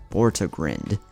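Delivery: ordinary delivery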